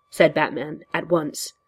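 ordinary delivery

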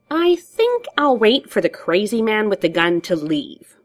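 high, drawling Joker voice, sliding down; sardonic Joker. Acted. Joker declines to get up for Batman, sardonic. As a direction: play the Joker, lazily unwilling.

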